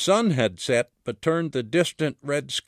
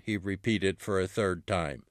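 ordinary delivery, nothing marked

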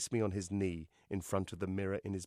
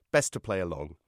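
plain reading voice

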